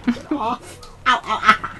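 laughing ow ow ow; laughing in pain. Real, not acted. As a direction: giggle through the scratches. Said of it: "clawing the fuck out of my thighs".

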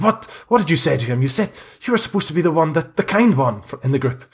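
accusing. Acted. Priest rounds on visitor over crying boy, accusing. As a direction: rush out an indignant accusation. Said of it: "he looks up at you accusingly".

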